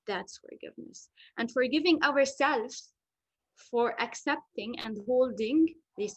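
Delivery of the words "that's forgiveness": whispered. Real, not acted. Quiet emphasis closing a point.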